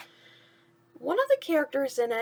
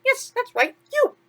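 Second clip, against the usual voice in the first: much higher and louder; confirming to Dumbo it's him; mouse voice, emphatic; 12 semitones higher, 7 dB louder than usual. Acted. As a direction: point right at him with delight. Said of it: "Timothy said".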